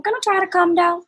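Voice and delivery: hammed-up self-imitation, trying to sound calm; mock forced calm. Forcing calm during a fight.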